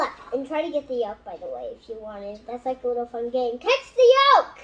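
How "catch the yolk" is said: excited. Real, not acted. Child calls out game name.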